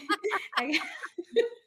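high burst of laughter; amused high-pitched laugh; laughing at herself. Real, not acted. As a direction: laugh at your own slip. Laughs at forgetting joy.

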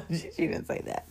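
whispered aside. Real, not acted. Quick aside after acted quote.

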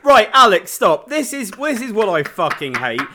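loud, exasperated "right, Alex, stop"; mock outburst. Host cuts his co-host off in loud mock anger. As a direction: bark it like you've had enough.

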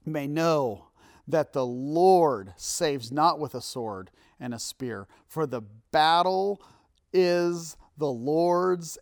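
slow, weighty preaching, key words drawn out; emphatic scripture proclamation. Acted. Preacher reads David's declaration to the Philistine, slow emphatic proclamation. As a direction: proclaim it loud and stately, stretching the big words.

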